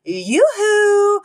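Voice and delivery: high sing-song yoo-hoo call; yelled yoo-hoo. Retold or imitated. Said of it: "I just yelled".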